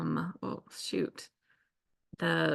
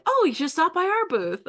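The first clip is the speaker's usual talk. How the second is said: much higher, bright friendly salesman; lightly voiced cheerful stranger; friendly invite; 11 semitones higher, 4 dB louder than usual. Sales guy invites them.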